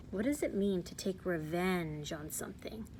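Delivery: soft, secretive whispered question. Real, not acted.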